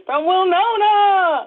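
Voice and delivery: loud, slow hype announcer. Acted. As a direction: stretch the name out like a hype man. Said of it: "I'm gonna do the hype voice today".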